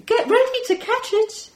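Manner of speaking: high, loud alien calling out; playful alien. Acted. Alien about to throw a muffin to the kids, high playful call. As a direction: warn them playfully like a space creature.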